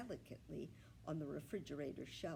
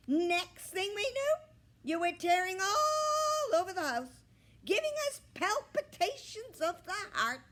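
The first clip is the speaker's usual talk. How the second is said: high, loud bird voice; shrieking bird; 16 dB louder than usual. A narrator's reading. Gabby the bird telling Millie about her arrival, high loud squawk. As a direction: squawk the memory dramatically.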